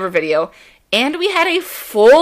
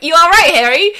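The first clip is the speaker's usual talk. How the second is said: higher, louder playful mock greeting; 7 semitones higher, 6 dB louder than usual. Host imitates [name] surprising [name], cheeky and loud. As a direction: pop up and greet him cheekily.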